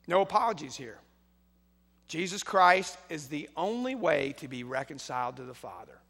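ordinary talk, nothing marked

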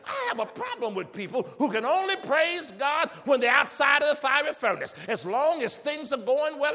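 loud, rising preacher's call to praise; full-force preaching. Acted. Pastor urging the church to praise, at full force. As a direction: preach it hard to the congregation, building.

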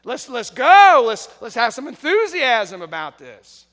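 loud, high rallying call to a sleepy crowd; hyped worship leader. Retold or imitated. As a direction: rouse the room like a worship leader shouting at sleepy kids. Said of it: "he's saying".

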